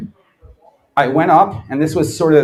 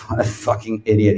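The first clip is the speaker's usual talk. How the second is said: muttered mockery; 6 semitones lower than usual. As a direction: scoff at him under your breath. Mocking rival under breath.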